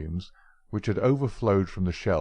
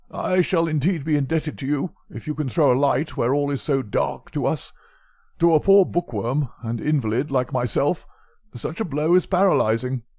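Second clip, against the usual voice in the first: put-on higher, louder old invalid pleading for help; old professor; 7 semitones higher, 4 dB louder than usual. Old professor begging Holmes for help, higher and louder. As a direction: flatter the man of action, helpless.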